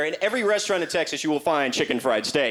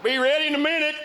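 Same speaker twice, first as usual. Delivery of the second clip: higher, jokey put-on voice; mock Texan; 10 semitones higher than usual. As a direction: play the flustered local. Texans stocking up before ice.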